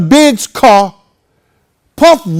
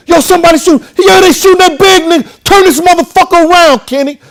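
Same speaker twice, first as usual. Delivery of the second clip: panicked shouting; 8 semitones higher, faster than usual. Retold or imitated. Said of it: "He said".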